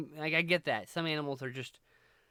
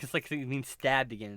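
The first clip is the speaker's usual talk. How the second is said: usual speaking voice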